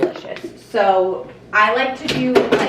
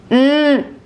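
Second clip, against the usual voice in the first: high, loud yummy hum; appreciative mm; 6 semitones higher, 5 dB louder than usual. Real, not acted. Vlogger tasting the fresh smoothie, appreciative mm. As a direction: hum with delight at the taste.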